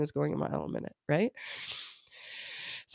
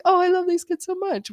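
gushing mom; 12 semitones higher, 6 dB louder than usual. Retold or imitated. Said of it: "You're like".

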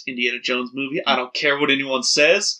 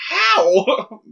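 high, louder imitation of shock; mock bafflement; 10 semitones higher, 4 dB louder than usual. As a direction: exclaim it like a dumbfounded friend. Retells friend's baffled question.

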